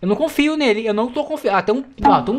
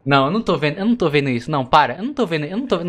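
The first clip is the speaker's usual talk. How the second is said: usual speaking voice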